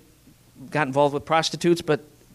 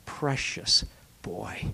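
much softer, slow and whispered; a father's soft, loving whisper; tender whisper; 6 semitones lower, 7 dB quieter, slower than usual. Acted. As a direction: hush it like comforting a child. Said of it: "this dad responds by saying".

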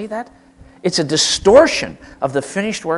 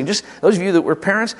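ordinary talk, nothing marked

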